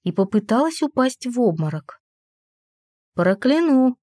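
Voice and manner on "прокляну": a bright, gleeful mock threat; cheerful threat. A narrator's reading. Heroine teasing the scared maid, gleeful threat. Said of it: "улыбнулась я радостно".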